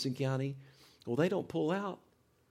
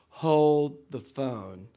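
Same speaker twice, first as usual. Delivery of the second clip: drawn-out catchphrase; 2 semitones higher, 9 dB louder, much slower than usual. Retold or imitated. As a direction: HOLD THE PHONE stretched very slow and loud. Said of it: "one of his sayings was".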